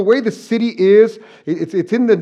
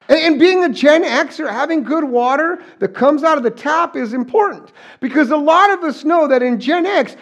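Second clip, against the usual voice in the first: excited; 8 semitones higher, 4 dB louder than usual. Real, not acted. Gen X nostalgia, hose water.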